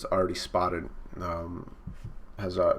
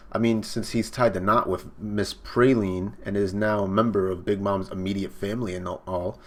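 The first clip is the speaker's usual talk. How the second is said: plain reading voice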